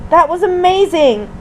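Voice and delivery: mild, thrilled squeal; excited squeal. A narrator's reading. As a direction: exclaim with excited wonder. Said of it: "Honey squealed excitedly".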